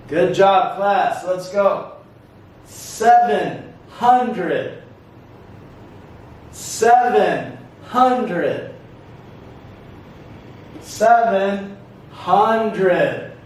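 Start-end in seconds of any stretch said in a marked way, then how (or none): 2.7-13.3 "Seven hundred" very slow, each word peaking early; "Seven hundred" split, each word falling; syllable stress demo. Shown as a demonstration. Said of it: "syllable stress".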